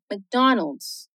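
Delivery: McDonald's with the C silent, no vowel after the M; McDonald's with a muted C; softly modelled McDonald's. Shown as a demonstration. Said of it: "o C pode ser mudo mesmo, fica assim".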